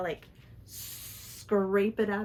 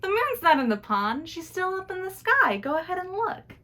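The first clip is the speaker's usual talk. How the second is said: higher, louder, chuckling gentleman; lightly voiced traveller amused at the sillies; 6 semitones higher, 5 dB louder than usual. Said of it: "he laughs and he goes".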